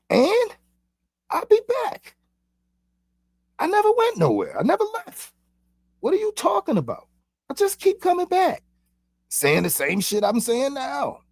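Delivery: high, otherworldly voice of an ancient one; lifted, eerie voice of a being who never left; returning ancient being. Acted.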